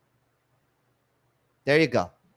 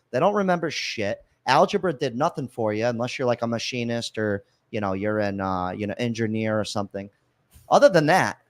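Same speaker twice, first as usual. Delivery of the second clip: normal talking voice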